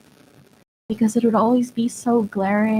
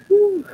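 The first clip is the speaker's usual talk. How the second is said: sharp high whew, shaking off emotion; exhale; 11 semitones higher, 5 dB louder than usual. Real, not acted. Streamer steadying herself after tearing up, a high sharp whew. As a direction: blow out a steadying breath. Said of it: "I'm gonna need a moment".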